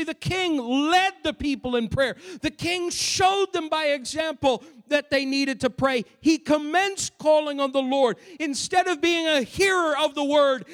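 forceful preaching. Real, not acted. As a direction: preach it hard, driving each point home.

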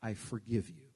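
"I forgive you" low and soft, tender; gentle forgiveness. Shown as a demonstration. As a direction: say it softly and sincerely. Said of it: "You learn to say".

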